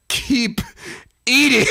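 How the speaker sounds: punchline. Acted. Punchline emphasis.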